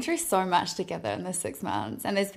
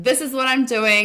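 loud, high, sure declaration; confident client; 5 semitones higher, 8 dB louder than usual. Retold or imitated. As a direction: announce it proudly, full volume. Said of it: "they're like".

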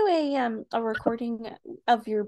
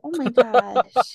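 embarrassed; 9 dB louder, much slower than usual. Real, not acted. Reacting to embarrassing story.

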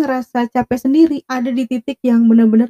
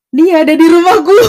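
louder, laughing disbelief; laughing reveal; 6 semitones higher, 7 dB louder than usual. Real, not acted. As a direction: break into a high laugh at the twist.

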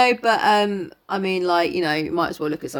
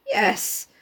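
plain reading voice

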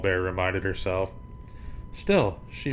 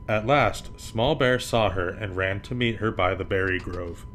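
ordinary delivery, nothing marked

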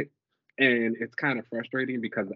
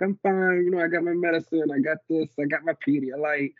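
high, louder testy brush-off; irritable reassurance; 5 semitones higher, 3 dB louder than usual. Retold or imitated. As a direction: snap that you are fine. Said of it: "I'm like".

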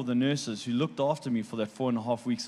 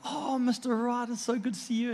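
imitated delighted greeting; 10 semitones higher than usual. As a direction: mimic a physio overjoyed to see her patient. Physio's delighted greeting retold.

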